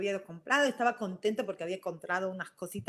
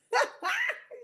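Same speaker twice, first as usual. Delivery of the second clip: high laugh. Real, not acted. Reliving the store scene for listeners: high laughter. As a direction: burst of high-pitched laughter. Laughing at her own outrage.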